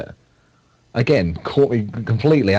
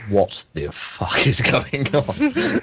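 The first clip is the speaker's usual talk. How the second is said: very slow, drawn-out disbelief, higher; baffled dad; 5 semitones higher, much slower than usual. Retold or imitated. Dad looking at his son, slowly baffled. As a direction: stretch every word in bewilderment.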